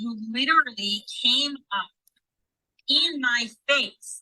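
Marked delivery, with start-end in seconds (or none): 2.9-4.2 "in my face" drawn out slowly, each word pressed; emphatic in my face. Real, not acted. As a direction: lean hard on "in my face". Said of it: "when I'm telling you in my face".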